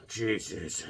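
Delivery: low, soft weary father; muttered exasperation. Retold or imitated. Father looking at his bleeding son, low exasperated mutter. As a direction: mutter it under the breath.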